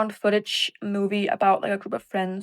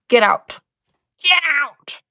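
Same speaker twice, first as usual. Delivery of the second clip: shouting get out; 5 semitones higher, 5 dB louder, slower than usual. Pretend. Yelling at the dead body.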